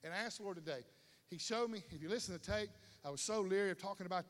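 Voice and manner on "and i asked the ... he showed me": normal talking voice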